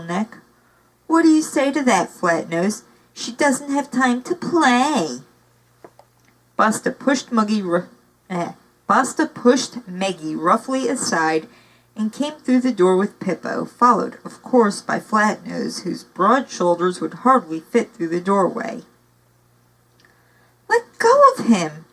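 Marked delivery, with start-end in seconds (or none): 1.1-5.2 sneering villain. Acted. Villain taunting captive. 20.7-21.8 high, loud snap at a bully; snapping. Acted. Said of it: "Maggie snapped at Basta".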